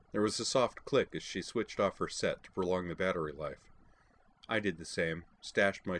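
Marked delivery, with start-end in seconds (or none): none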